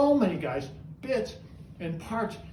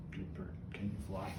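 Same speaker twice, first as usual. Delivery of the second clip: much softer, lower praise to the bird; 12 semitones lower, 15 dB quieter than usual. Real, not acted. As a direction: murmur praise to the pet. Praising the parrot softly.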